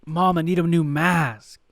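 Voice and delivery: whiny request. Retold or imitated. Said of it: "And he's like".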